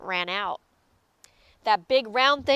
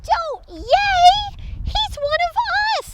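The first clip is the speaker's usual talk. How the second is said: high, squeaky-small scolding cry; urgent small-creature protest; protesting Pip; 3 dB louder than usual. A narrator's reading. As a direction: object in a high little voice. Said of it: "said Pip".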